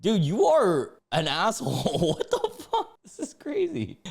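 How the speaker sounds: loud, then squealing with laughter; incredulous laughing outburst. Real, not acted. Calling out the husband in the post, laughing incredulously. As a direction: exclaim in high laughing disbelief.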